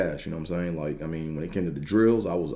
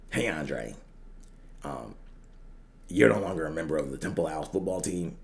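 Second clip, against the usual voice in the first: coach voicemail; 6 dB quieter than usual. Retold or imitated. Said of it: "basically the message was".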